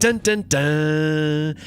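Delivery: slow, drawn-out dun dun dun; dramatic sting. Pretend. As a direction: hum a suspense sting like a game show. Dramatic game show sting.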